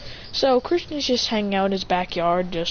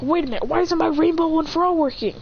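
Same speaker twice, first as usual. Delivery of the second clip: higher, mock-dismayed question; acted bewilderment, pitched up; puzzled hero; 7 semitones higher than usual. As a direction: question a failed power in disbelief.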